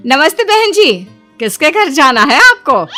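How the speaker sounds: high, loud, friendly hail; bright greeting call. Acted. Villager hailing a newcomer woman, calling out. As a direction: call out politely to a stranger.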